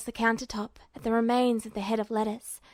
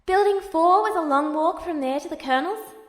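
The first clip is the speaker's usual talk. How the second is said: put-on higher, lighter boyish voice; eager younger brother; 5 semitones higher, 3 dB louder than usual. A narrator's reading. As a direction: sound young and eager on the phone.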